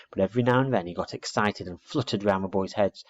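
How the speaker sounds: plain reading voice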